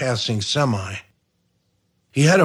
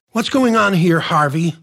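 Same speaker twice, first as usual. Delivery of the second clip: sharp demand; 7 semitones higher than usual. A narrator's reading. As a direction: cut in sharply to get answers. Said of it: "said sharply".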